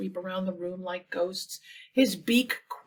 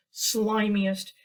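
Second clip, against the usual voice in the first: ordinary delivery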